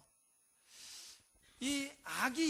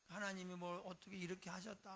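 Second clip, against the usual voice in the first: ordinary delivery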